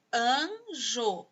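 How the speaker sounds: "Anjo" drawn out in two separate syllables. Shown as a demonstration. Teacher showing two sounds to pupils, "Anjo" syllable by syllable. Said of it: "ela tem dois sons".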